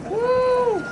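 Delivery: high, loud cheer; excited whoop. Real, not acted. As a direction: let out a happy whoop. Recalling hauling drums downhill.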